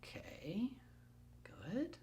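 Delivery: whispered aside; hushed off-camera aside. Real, not acted. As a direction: whisper it so the camera barely hears.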